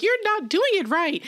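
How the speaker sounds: high-pitched bossy scolding, imitated; Emmy. Host quotes the older sister correcting her brother, high mock scolding. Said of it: "Emmy's like".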